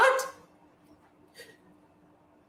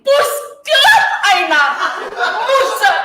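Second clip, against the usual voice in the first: starts loud and builds to a full yell, laughing in fury; cursing rant; 4 semitones higher, 9 dB louder than usual. Acted. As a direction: swear it out, louder each time, until you're roaring.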